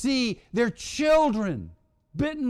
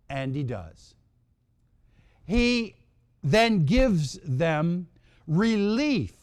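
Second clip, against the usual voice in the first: ordinary talk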